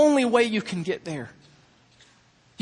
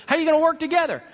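higher, playful questioning dad; teasing dad; 6 semitones higher, much faster than usual. Retold or imitated. Father challenging his sons at the stairs, playfully. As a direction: needle the boys with a challenge.

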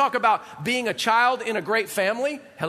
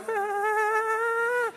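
long, high, held sheep's baa; imitated sheep bleat; 12 semitones higher than usual. Preacher mimicking the little flock, high sheep bleating. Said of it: "sheep walking around going".

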